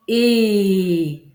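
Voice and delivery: "é" held long and loud; isolated, stretched sound. Shown as a demonstration. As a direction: sustain a single "é" vowel. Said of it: "capable d'isoler mon premier phonème".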